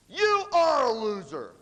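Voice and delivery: loud, high, drawn-out jeer; hammed-up slow insult yell; shouted taunt. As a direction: jeer it slowly across the room. Retold taunt called after speaker.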